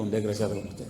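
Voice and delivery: low, soft, calm reply; understated low answer; quiet retort. Retold or imitated. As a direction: echo it back calmly, voice dropped. His quiet reply to the shouting.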